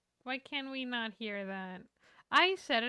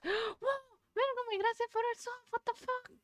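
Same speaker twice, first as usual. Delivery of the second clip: excited squeal; 9 semitones higher than usual. Real, not acted.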